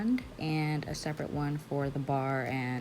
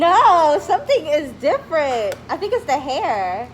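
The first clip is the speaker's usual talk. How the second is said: high, loud gushing praise; excited squeal; 11 semitones higher, 15 dB louder than usual. Real, not acted. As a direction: gush over her new look. Hyping up coworker.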